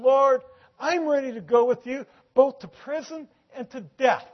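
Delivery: loud, high, impassioned declaration; fervent vow. Acted. Peter vows loyalty to the Lord, loud and fervent. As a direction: proclaim it at full preaching force.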